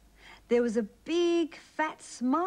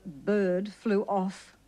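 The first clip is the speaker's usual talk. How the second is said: ordinary delivery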